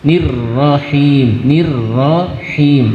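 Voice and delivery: slow "nirrohim" with the last h unstressed; don't press the final h; light final ha. Shown as a demonstration. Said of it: "belakang jangan ditasjid, jangan ditekan". (ha belakang tidak ditekan)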